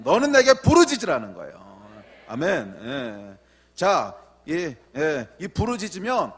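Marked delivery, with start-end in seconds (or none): 0.0-1.8 high, loud question and falling, hammered answer; full-force preaching. Real, not acted. Preacher asks congregation what to do in each crisis, fervent and loud. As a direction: work the congregation up in call and answer.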